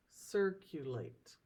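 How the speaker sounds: circulate broken slowly into syllables; cir, cu, late, spaced out slowly; syllables split. Shown as a demonstration. Teacher splits circulate for class, slowly syllable by syllable. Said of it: "how we would split the syllables".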